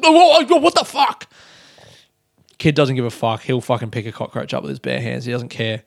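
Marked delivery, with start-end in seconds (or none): 0.0-1.3 high, loud mock panic; re-enacted high-pitched alarmed shout; startled freak-out. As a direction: yelp it in shocked disgust. Retelling finding cockroach on shirt.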